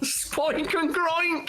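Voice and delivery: high, drawn-out mock-triumphant cry; gleeful exclamation. Shown as a demonstration. Friend delighted by the German names, gleeful cry. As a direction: crow both silly names, stretched out.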